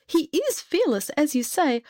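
high-pitched, fretful exclamation; crying out. A narrator's reading. Mother answers the fairy over her clawed son, crying out. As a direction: protest in a high, distressed voice. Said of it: "cried his mother".

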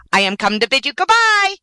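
high-pitched, eager bird voice; excited cry. A narrator's reading. Swallow bidding the prince farewell, excited cry. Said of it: "he cried".

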